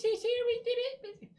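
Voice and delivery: tiny, very high squeaky whine; mocking high-pitched blubber; mock whimpering apology.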